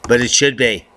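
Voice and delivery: normal talking voice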